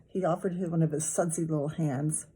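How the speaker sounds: plain reading voice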